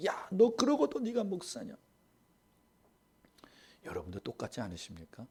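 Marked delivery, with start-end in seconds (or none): 0.1-1.8 higher-pitched taunting voice of the devil; 마귀처럼 높은 목소리로 조롱하듯; devil's mockery. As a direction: sneer it up high like a taunting devil. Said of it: "마귀도 그렇게 말해요".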